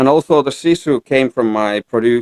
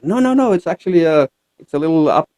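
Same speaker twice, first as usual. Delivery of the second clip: higher-pitched retold "no, no" reassurance; quick protest; 8 semitones higher than usual. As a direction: protest in a higher voice, lightly acted. Retelling his protest to wife's question.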